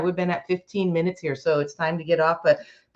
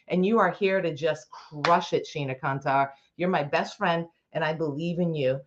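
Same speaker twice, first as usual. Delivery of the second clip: ordinary delivery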